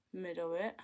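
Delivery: ordinary talk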